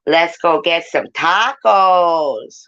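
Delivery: drawn-out, playful mimicry; quoting the actress. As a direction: stretch it out like a quoted show line. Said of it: "she said".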